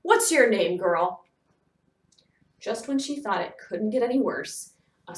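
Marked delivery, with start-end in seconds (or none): 0.0-1.2 loud, curt interrogation; commanding scowling boss; stern demand. A narrator's reading. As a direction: demand an answer from an intruder. Gruff superintendent interrogates the girl.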